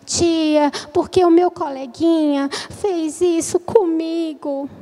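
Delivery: drawn-out, unhurried telling; very slow child. Acted. Kinesthetic child telling teacher about a classmate, very slowly. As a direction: take your time like a slow-talking child. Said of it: "Eu falo bem devagarzinho".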